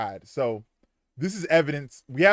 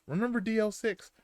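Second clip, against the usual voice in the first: high, soft sinister quote; a hint of a menacing caretaker; menacing killer line; 8 semitones higher, 5 dB quieter than usual. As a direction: hiss it as the killer before firing. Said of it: "and said".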